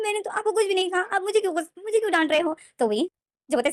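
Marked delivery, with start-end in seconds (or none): 0.0-2.6 put-on much higher, plaintive child voice; high, innocent whine of a hurt child; childlike hurt voice. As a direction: sound like a scolded little boy.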